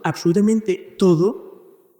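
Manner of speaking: ordinary talk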